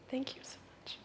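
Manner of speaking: breathless whispered thanks. Real, not acted. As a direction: whisper it, barely voiced.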